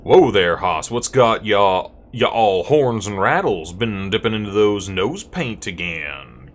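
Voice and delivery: hammed-up Wild West cowboy; loud, folksy cowboy teasing; cowboy character. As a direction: drawl it out like an old-time cowpoke. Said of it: "Gabby doesn't talk like this".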